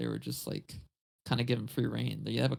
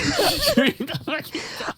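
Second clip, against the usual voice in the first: high, loud, drawn out through laughter; cracking up; 10 dB louder than usual. Real, not acted. Telling his co-host the funny episode, real helpless laughter. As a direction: shout it while laughing hard.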